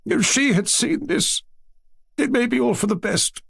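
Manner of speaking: very high, grief-stricken grandmother; tearful lament. A narrator's reading. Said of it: "wiping away her tears".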